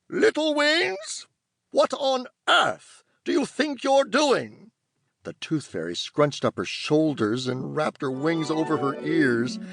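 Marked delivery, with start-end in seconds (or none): 0.1-4.7 high, slow scolding auntie; stern aunt. Acted. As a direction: catch the fairy red-handed.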